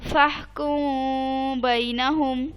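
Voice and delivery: फहकुम बैनहुम recited very slowly, मीम hummed through the nose; slow Quranic chant, nasal ghunna held on मीम; ghunna recitation. Shown as a demonstration. Teacher reciting for her student, slow nasal ghunna on मीम. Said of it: "जो मैं गुन्ना कर रही हूँ मीम पर".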